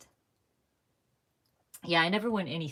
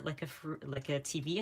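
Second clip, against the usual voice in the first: usual speaking voice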